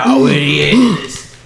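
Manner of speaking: put-on slow, slurred drunk voice; drunk imitation. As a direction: drag it out like you're wasted. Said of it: "He's just drunk as shit".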